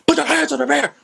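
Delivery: a hammed-up cop barking the order high and loud; imitating a cop's yell. Imitating a cop ordering them out of the car, mock yell. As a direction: bark it like a cop on a traffic stop.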